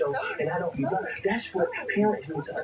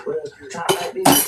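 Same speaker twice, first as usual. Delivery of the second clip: mock screaming; 7 dB louder than usual. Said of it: "I gotta start screaming on the screen".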